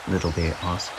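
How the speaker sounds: plain reading voice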